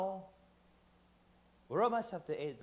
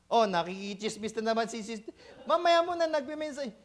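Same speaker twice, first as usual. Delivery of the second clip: normal talking voice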